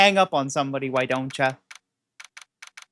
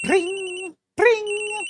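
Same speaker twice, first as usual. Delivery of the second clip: high chirpy ring ring; imitated phone ring; 12 semitones higher than usual. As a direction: mimic a ringing phone.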